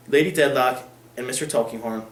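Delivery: plain reading voice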